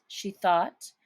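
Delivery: ordinary delivery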